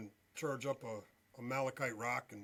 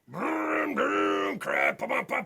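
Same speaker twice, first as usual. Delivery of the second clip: loud, high revving engine imitation; engine noise; 12 semitones higher, 11 dB louder, much slower than usual. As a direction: rev it up and pop like a diesel. Big diesel truck pulling in.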